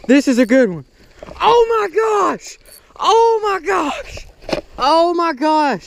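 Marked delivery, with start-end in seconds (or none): 1.4-5.9 high, slow drawn-out dismay; slowly groaning at the lost fish; stunned disbelief. Real, not acted. Just lost his biggest bass, high and slow in dismay. Losing the seven pounder.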